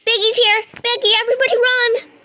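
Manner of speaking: loud, high alarm as the monster appears; panicked shout. Real, not acted. Player screaming a warning at the monster's arrival, panicked. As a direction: yell for everyone to flee.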